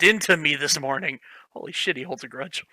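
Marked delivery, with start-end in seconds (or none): none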